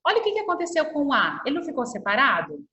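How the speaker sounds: ordinary delivery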